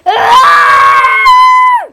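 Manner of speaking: very high, long, loud scream; acted scream. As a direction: scream and hold it. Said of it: "And she's like".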